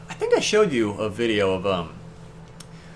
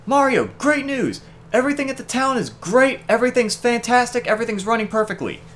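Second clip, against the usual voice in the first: happy Toad; 11 semitones higher than usual. Retold or imitated. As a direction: gush the good news like a grinning Toad. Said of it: "Toad running at him with a big smile".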